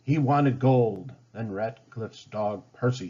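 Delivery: ordinary delivery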